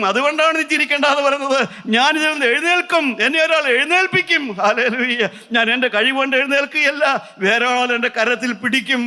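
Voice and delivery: high, fast, urgent pulpit voice; rising preaching. Acted. Preacher assuring the faithful they will rise, urgent fast preaching. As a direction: drive the promise home quickly.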